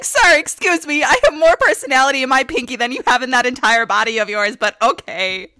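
indignant retort to a rude date; sassy comeback. Retold or imitated. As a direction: clap back with attitude, loud. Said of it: "I was like".

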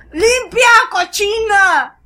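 loud, high, slow mock scold; scolding dad. Dad orders his daughter to clean up, loud mock scold. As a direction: bark it like a disgusted father.

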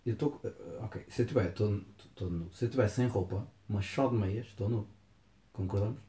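ordinary talk

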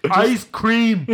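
high loud goofy yell; Forrest Gump. Retold or imitated. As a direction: bellow it in a dopey high voice. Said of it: "I'm talking like Forrest Gump".

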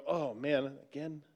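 quiet, low mock groan to oneself; softly acted weary complaint; weary grumble. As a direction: imitate a sore morning grumble, fading away. Voices listeners getting up aching.

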